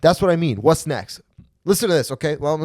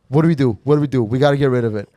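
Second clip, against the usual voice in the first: ordinary delivery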